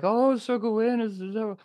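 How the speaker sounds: high, swooning women's voices; mock lamenting court ladies. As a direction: wail in a high, hammed-up swoon. Court women lament their lover's marriage.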